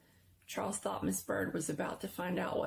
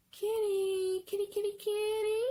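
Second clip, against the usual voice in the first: eerie singsong call; 14 semitones higher, 4 dB louder than usual. A narrator's reading. Unseen voice luring the boy around the corner, eerie high singsong. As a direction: lure him in sweetly, high and thin.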